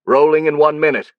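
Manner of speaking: high, loud call across the set; shouting. A narrator's reading. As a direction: call it out to the whole crew. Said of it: "he shouted to the crew".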